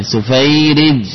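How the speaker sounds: سفيرج drawn out slowly; slow demo of سفيرج. Shown as a demonstration. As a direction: spell out سفيرج slowly for students. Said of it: "إذا أردت تصغيره".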